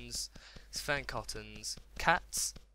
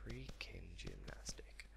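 slow, unvoiced hush; whisper; 17 dB quieter, slower than usual. Real, not acted. Gamer savouring his gymnastic moves, slow whisper. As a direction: whisper it slowly to yourself.

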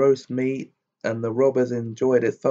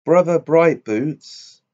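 loud, slow, higher call across the table; 4 semitones higher, 6 dB louder, slower than usual. Acted. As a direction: call Brother Brightboots across the room. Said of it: "cried he to the huntsman".

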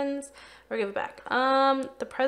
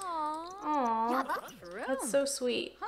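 aww; 4 semitones higher, 4 dB quieter, much slower than usual. Real, not acted. Cooing over the game characters.

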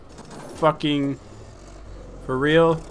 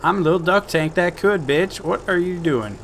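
higher, chirpy cartoon tank; duck tank voice; 6 semitones higher than usual. Player voicing his happy tank to the audience, higher cartoon voice. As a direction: voice a cheerful little tank, pitched up. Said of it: "He's like".

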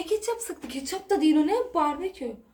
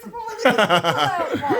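laughing; 3 semitones lower, 3 dB louder than usual. Real, not acted. Laughing mock threat to husband.

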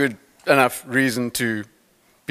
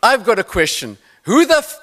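a hint of Joburg accent, challenging; hint of a Joburg accent, heckling; 5 semitones higher, 3 dB louder than usual. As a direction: lightly do a gruff Joburg player heckling. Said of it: "a Joburg accent said".

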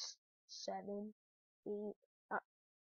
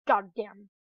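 ordinary talk

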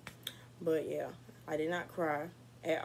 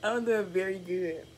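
laughing through the words; real laughter breaking in; 4 semitones higher, 3 dB louder than usual. Real, not acted. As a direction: giggle while saying you're fine. Laughing about how she is doing.

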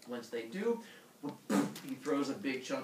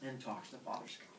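plain reading voice

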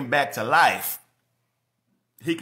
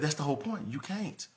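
quiet answer; 11 dB quieter, faster than usual. Retold or imitated. God's calm answer, retold.

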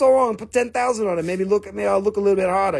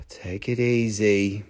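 very low, drawn-out, soft; slow self-calming; 12 semitones lower, 4 dB quieter, slower than usual. Pretend. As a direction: soothe yourself slowly, deep down. Calming himself after the rant.

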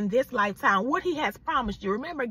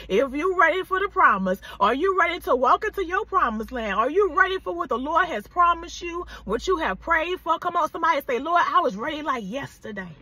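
louder, higher sermon build; preacher rallying the viewers, louder; fervent preaching; 3 semitones higher, 4 dB louder than usual. Acted. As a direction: push them to the promise. Preacher builds to full force.

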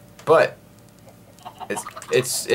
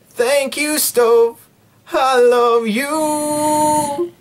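slow, much higher and sweet; high sappy voice; 12 semitones higher, much slower than usual. Pretend. Gamer thanking the stove, slow high coo.

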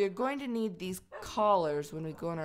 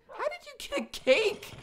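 high, marvelling squeal; amazed squeal. Real, not acted. Asking her dog about the cake, high and amazed.

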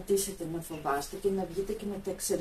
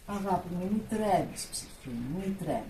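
much softer, slow, tender reassurance; soft soothing; 9 dB quieter than usual. Acted. Calming trembling little animals, soft slow soothing. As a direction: hush them, slow and tender.

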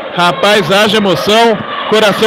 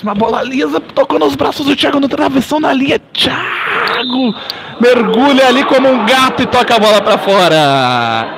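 rising excitement; 5 semitones higher than usual. Acted. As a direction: pitch jumps higher on the near miss. Near miss, voice climbs.

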